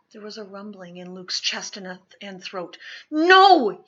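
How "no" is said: sudden loud cry of no; boy's desperate yell at the man. Acted. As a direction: shout it out in alarm. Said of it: "he yelled".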